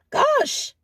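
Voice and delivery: sudden high-pitched "Gosh" of disbelief; exasperated gosh. Real, not acted. Vlogger exasperated at South Africans, high sigh-word. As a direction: throw up your hands with a high "Gosh".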